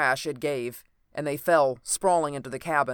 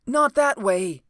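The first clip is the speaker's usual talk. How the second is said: higher, louder urgent cry; cried out; 8 semitones higher, 7 dB louder, slower than usual. A narrator's reading. Wounded man correcting his rescuers, crying out. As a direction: stop them with a raised voice. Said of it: "cried the voice".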